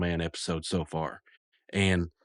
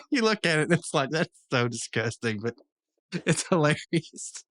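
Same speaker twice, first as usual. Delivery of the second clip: high, giggly laugh through the words; 7 semitones higher than usual. Real, not acted. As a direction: laugh through it, tickled and grossed out. Amused by the gross joke he retells.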